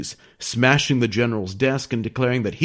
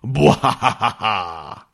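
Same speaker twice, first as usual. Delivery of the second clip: wild unhinged ranting; much slower than usual. Pretend. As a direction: cackle like a cartoon villain. Villainous aliens plot their mission.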